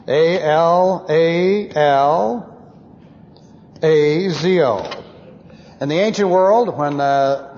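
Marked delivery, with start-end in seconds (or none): 0.1-4.8 slow letter-by-letter spelling of A L A L A Z O. Shown as a demonstration. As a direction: spell each letter slowly for the class. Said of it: "the Greek word".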